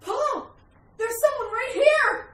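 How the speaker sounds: high, rising panic; high frightened shout; terrified cry. Acted. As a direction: cry out to your husband in sudden terror. Wife suddenly sees intruder.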